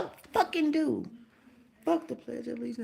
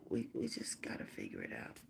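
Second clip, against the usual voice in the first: much lower and softer, almost whispered; hushed whisper; 13 dB quieter than usual. Real, not acted. Pleading with viewers to get along, whispered. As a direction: breathe it out, worn down.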